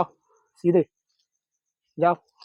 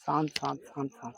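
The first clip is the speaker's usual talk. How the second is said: hushing softly; 6 semitones lower, 15 dB quieter than usual. Real, not acted. Hushing the snake.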